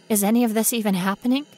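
fast, loud panicked question; frantic questioning; rising panic. Acted. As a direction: let the panic break through.

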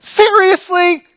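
high, loud, drawn-out incredulity; frustrated. Acted. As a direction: burst out in acted exasperation. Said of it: "I'm gonna be really frustrated".

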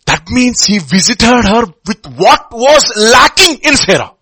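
loud, high, slow, voice breaking; tearful preaching. Real, not acted. Pastor moved to tears about God filling Sarah's lack. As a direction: let the emotion break through.

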